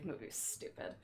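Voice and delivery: whispered hot take, voice dropped. Real, not acted. Streamer whispering her unpopular opinion. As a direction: confide it under the breath.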